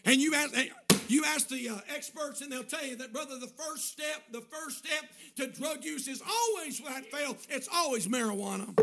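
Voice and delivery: loud, high, emphatic sermon delivery; full-force preaching. Real, not acted. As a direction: drive each warning home to the congregation.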